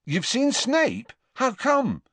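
high, slow, astonished questions; surprised Ron. A narrator's reading. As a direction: gape at the news about Snape. Ron surprised mid-mouthful.

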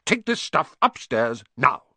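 high, loud, impatient order; snapping. A narrator's reading. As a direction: snap a command at your nephew. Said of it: "snapped his uncle".

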